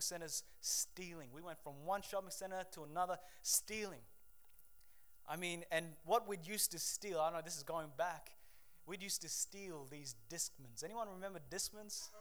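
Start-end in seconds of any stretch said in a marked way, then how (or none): none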